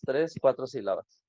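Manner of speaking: normal talking voice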